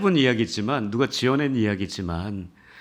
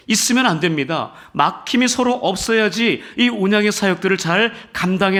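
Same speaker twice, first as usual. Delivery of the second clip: higher and louder, driving the point home; fervent preaching; 9 semitones higher, 7 dB louder than usual. Acted. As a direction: preach it with rising fire to the congregation. Exhorting congregation.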